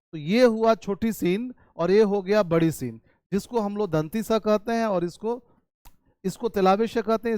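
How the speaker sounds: ordinary talk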